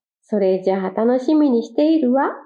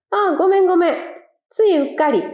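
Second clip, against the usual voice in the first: higher apologetic quail; 8 semitones higher, faster than usual. Acted. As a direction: brush it off with a sheepish sorry. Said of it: "謝りました".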